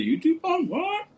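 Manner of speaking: sharp high disbelieving what; shocked by the idea; surprised. Real, not acted. Reacting to a YouTube phone.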